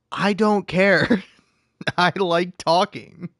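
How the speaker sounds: slow laughing don't-care; defiant shrug. Real, not acted. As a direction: brush off the haters slowly. Defiant reply to imagined critics.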